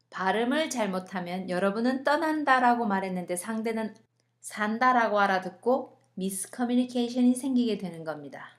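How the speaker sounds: normal talking voice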